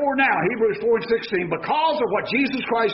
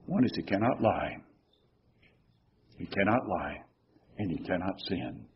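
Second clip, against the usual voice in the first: hushed emphasis; 13 semitones lower, 9 dB quieter than usual. Real, not acted. Dropping voice on God's limits.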